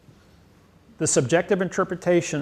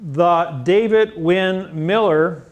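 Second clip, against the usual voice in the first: word-by-word demonstration; 2 semitones higher, 4 dB louder, much slower than usual. Shown as a demonstration. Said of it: "if I'm gonna go ahead and say".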